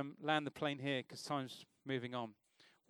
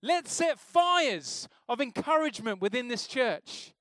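full-force preaching; 7 semitones higher, 9 dB louder than usual. Acted. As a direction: drive the warning home from the pulpit.

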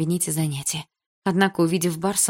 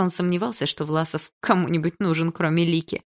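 plain reading voice

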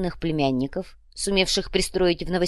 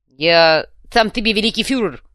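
loud, puffed-up character voice; self-important fuhrer; 3 semitones higher, 8 dB louder than usual. A narrator's reading. Kuvalda declares his title, self-important. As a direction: proclaim the title with bluster. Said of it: "мгновенно ответил Кувалда".

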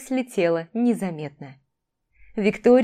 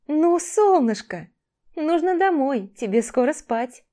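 coaxing gently; 7 semitones higher, slower than usual. A narrator's reading. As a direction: coax a child home kindly. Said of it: "попыталась она убедить девочку". (ласково уговаривая)

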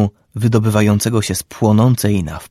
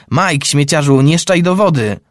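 put-on boy shouting high across the water; high, loud shout at a mate in the sea; yelled; 6 semitones higher, 3 dB louder than usual. A narrator's reading. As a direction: yell it at a friend splashing nearby. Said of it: "wrzasnął Todd".

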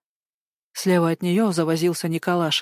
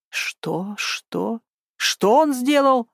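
shocked disbelief; 4 semitones higher, much slower than usual. Acted. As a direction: build from stunned to outraged.

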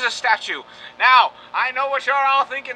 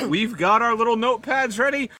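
ordinary talk, nothing marked

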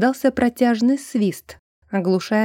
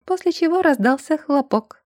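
ordinary delivery, nothing marked

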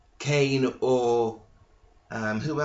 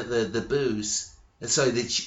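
ordinary talk

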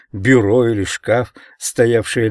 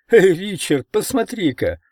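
much higher, giddy call; elated laughing call; 10 semitones higher than usual. A narrator's reading. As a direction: cry out, laughing like mad. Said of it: "смеясь как безумный".

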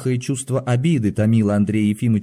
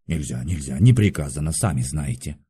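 low, subdued guard's voice; guard refusing; 7 semitones lower than usual. A narrator's reading. Guard turns the patient back from the door, low. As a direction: refuse in a low, matter-of-fact rumble.

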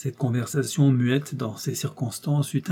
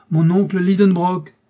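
calls his uncle louder, pitch up; raised voice; 6 semitones higher, 7 dB louder than usual. A narrator's reading. Nephew calling the absent-minded uncle, raised voice. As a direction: raise the voice to get his attention. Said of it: "répétai-je en élevant la voix".

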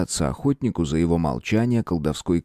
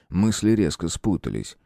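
usual speaking voice

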